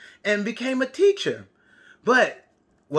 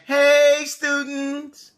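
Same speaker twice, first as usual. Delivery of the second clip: teacher greeting; 6 semitones higher, 4 dB louder, much slower than usual. Retold or imitated. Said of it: "it's me saying".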